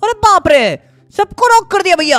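loud, high cry of shock; alarmed exclamation. Real, not acted. Player seeing the whole team knocked out, alarmed exclamation.

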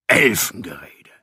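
hissed dwarf scorn, breathy and rough; blurted scorn. Acted. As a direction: spit the word out contemptuously. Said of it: "platzte Sheldon Skaggs heraus". (abfällig herausgezischt)

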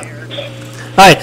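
hoarse, nearly voiceless; losing voice, raspy whisper. Real, not acted. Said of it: "I'm losing my voice here".

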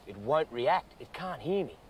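normal talking voice

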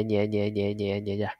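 softly repeated, fading away; light, soft. Shown as a demonstration. Teacher shows learners how lightly the S hisses, fading softly. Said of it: "nó xì nhẹ".

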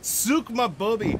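put-on Scottish accent. Repeating a phrase from watching Still Game, Scottish accent. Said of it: "I feel like you need the accent".

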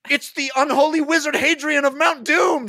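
high, loud panicked shriek. Acted. Chambers sees Hadrian at the door, loud shriek. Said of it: "shrieked Chambers".

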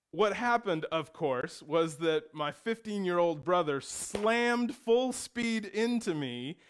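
ordinary talk

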